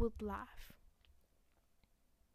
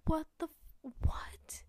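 sudden higher, louder "what the f-", then a breathy "what?"; stunned disbelief; 6 semitones higher, 5 dB louder, slower than usual. Retold or imitated. Sister reliving her brother's crude message, stunned disbelief. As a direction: replay the moment the words failed you. Retold shocked reaction to brother.

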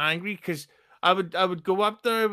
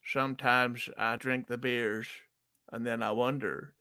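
put-on a lightly done Texas accent, philosophical; 4 semitones lower, 4 dB quieter than usual. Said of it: "Say it, do it, try it again".

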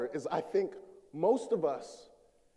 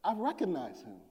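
ordinary talk, nothing marked

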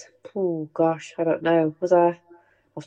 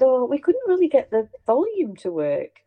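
lightly raised innocent tone; mock innocent; 6 semitones higher than usual. Pretending to Dad the volume is broken, mock innocent. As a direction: feign innocence about the volume. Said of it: "We said".

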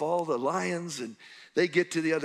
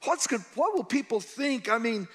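rising, higher panicked questions building to a shout; frantic Joseph; 6 semitones higher than usual. Acted. Preacher voicing Joseph's fears to the crowd, frantic shout. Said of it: "He's so frantic".

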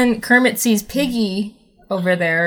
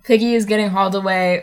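normal talking voice

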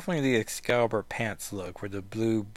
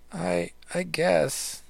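slow, higher, wavering concession; shaky reluctance; 7 semitones higher, much slower than usual. Acted. Rachel conceding to Kitty, shaky and reluctant. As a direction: give in haltingly, voice raised in pitch. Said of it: "Great word bubble with, like, the shakiness of it".

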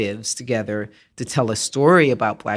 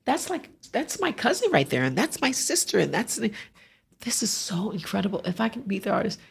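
put-on higher, soft, wondering old woman voice; awed older woman; 9 semitones higher, 6 dB quieter than usual. An older woman at the painting tells her family, high soft awe. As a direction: voice her hushed amazement, raised high. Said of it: "She's like".

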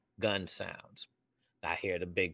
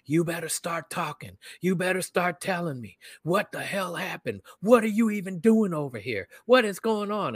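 high, heated mock interrogation, building louder; retold screaming; 9 semitones higher, 3 dB louder than usual. Sergeant to silent suspect in the car, imitated screaming. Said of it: "is in there screaming at me".

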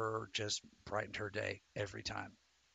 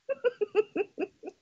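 laughing; 6 dB louder than usual. Real, not acted. Playful admission then laughing.

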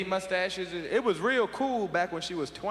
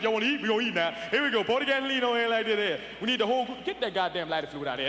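Uncle Gus bossing at the grill; 3 semitones higher, faster than usual. Retold or imitated. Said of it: "As soon as he walk in the house, it's like".

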